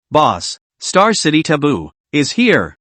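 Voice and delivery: ordinary delivery, nothing marked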